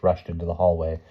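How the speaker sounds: ordinary delivery, nothing marked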